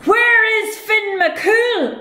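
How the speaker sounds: slow, loud, high booming giant; giant roaring. Acted. Scottish giant roars for Finn from the shore. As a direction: roar it out slowly. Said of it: "a voice roared".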